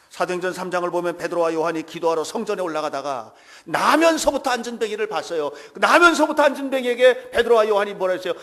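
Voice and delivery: loud, fervent sermon delivery; preaching at full force. Acted. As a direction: preach it out with full conviction to the congregation. Preaches loudly.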